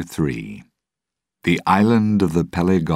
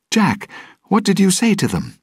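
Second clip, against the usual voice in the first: very high, alarmed cry; screamed demand; 4 dB louder than usual. A narrator's reading. Captive Will demands answers from Jack, screamed. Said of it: "Will screamed".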